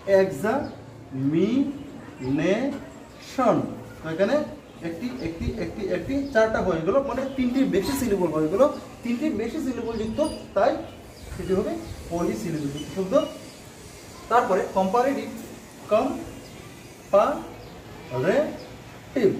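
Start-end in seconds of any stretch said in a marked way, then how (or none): 0.1-3.6 examination very slowly in four separate beats; slow teacher's syllable breakdown. Shown as a demonstration. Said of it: "syllable হিসাবে লিখি". 15.9-19.3 comparative very slowly in four separate beats; slow teacher's syllable breakdown. Shown as a demonstration. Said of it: "syllable হিসাবে লিখি".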